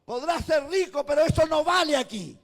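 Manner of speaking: high, loud fiery sermon voice; preaching at full force. Acted. Pastor rebuking the rich church, loud fiery preaching. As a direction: thunder it out over the congregation.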